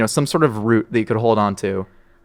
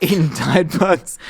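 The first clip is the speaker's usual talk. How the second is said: mock slow repeat; 4 semitones higher, slower than usual. Echoing cohost's joke.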